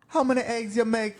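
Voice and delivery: high-pitched imitation of a mother; Jamaican mom. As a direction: imitate a mother demanding an answer. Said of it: "And his mom's like".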